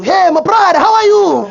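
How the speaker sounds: high, loud shouted greeting; loud gym bro hello. Retold or imitated. Second gym regular greeting him, high and shouting. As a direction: hail him across the gym floor.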